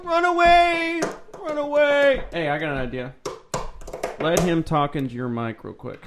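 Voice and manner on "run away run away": high, loud silly panic cry; Monty Python cry. Acted. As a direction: flee shrieking like a knight. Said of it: "That sounded like Monty Python".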